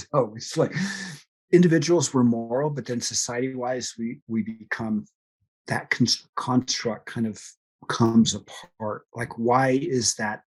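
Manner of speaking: ordinary delivery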